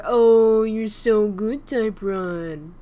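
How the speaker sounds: loud, slow gushing praise; shower them with praise; loud delighted oh, slow you're so good; excited praise. Real, not acted. Praising student answer.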